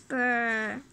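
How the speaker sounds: low, held "brr"; drawn-out vocal rumble; imitated brr noise. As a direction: make a long low brr sound. Acted noise in the story.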